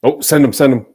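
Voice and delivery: shouted, urging the player forward; loud urging. Real, not acted. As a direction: yell at the screen to send him. Yelling at the players.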